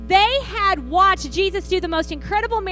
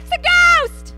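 high, loud terrified cry; frightened cry; 12 semitones higher, 6 dB louder, slower than usual. A narrator's reading. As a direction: yelp it out in sudden fear. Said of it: "In their fear, they cried out".

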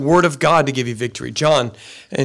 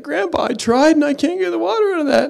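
put-on much higher, fast kid's whine; whining boy; 12 semitones higher than usual. Grandson complaining to grandpa, high and fast. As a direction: plead like a frustrated little boy. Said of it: "and he's like".

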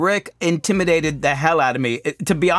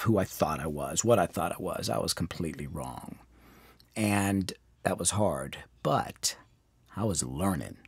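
much lower and softer, near a murmur; humbled, hushed; 9 semitones lower, 10 dB quieter than usual. Real, not acted. Confessing his false self-image, dropping to a hush. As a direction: let it sink as you admit you were wrong.

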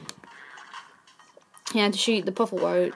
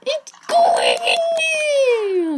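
very high, loud, drawn-out scream; frantic squeal; 9 dB louder, much slower than usual. Real, not acted. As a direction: squeal wildly at the game, stretching the words. Yelling at the game.

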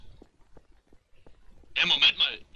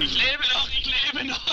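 relieved excited; 8 semitones higher than usual. Real, not acted. Survives the attack.

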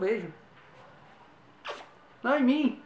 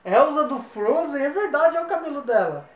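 slow, higher, louder playful amazement; mock surprise; 7 semitones higher, 6 dB louder than usual. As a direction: act amazed for the kids. Magician feigns surprise.

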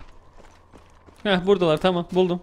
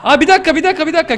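startled; 8 semitones higher, 6 dB louder, faster than usual. Real, not acted. Caught off guard in fight.

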